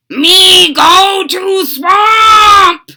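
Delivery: loud, high, slow croak; booming slow frog monster. Acted. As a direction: croak it stubbornly. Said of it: "he croaked".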